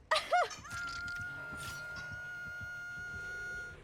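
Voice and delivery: long shrieking fit of laughter; high giddy laugh. Real, not acted. Giddy laugh after scream.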